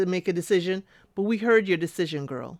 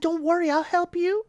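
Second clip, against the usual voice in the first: higher mock mother voice; lightly voiced eager mama; imitating Mama; 9 semitones higher than usual. Mama promising Bada help with grandkids, higher mock voice.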